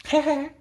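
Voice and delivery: high little two-beat mock baby giggle; imitated baby laugh. Teacher showing what a newborn does, mock giggling. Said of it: "बच्चा क्या करता है".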